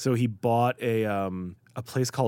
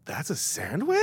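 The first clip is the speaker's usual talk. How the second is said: surprised; 8 semitones higher, slower than usual. Retold or imitated. Child self reacting to huge sandwich.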